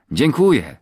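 loud, high, heartfelt thanks; sincere exclamation. A narrator's reading. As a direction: exclaim it wholeheartedly. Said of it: "wykrzyknął szczerze". (szczery okrzyk, głośno i wysoko)

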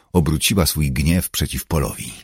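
plain reading voice

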